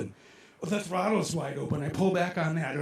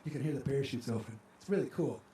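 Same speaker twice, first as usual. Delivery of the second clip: hushed, softer storytelling; quiet; 4 semitones lower, 7 dB quieter, faster than usual. Shown as a demonstration. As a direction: drop the voice to show the stillness. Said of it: "it's quiet like this".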